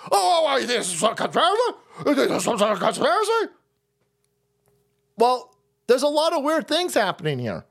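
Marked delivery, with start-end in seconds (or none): 0.1-3.5 fast, laughing mock voice of the critics. Mocking critics who cry conspiracy, laughing mock voice. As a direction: sneer at them through laughter. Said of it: "they go".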